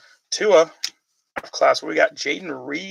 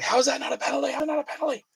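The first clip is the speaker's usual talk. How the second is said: high-pitched mock yell, replaying the outrage; retold angry yelling; 12 semitones higher, faster than usual. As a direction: imitate yelling at the TV in disbelief. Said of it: "I just kept yelling".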